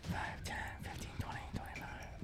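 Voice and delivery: very soft whispered count; counting under breath. Real, not acted. Game master counting distance on map, whispered. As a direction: whisper the numbers to yourself.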